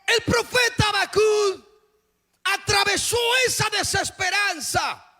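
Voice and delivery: climbing sermon shout; fiery preaching. Acted. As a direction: preach it out to the whole church at full force. Preacher proclaims the prophet.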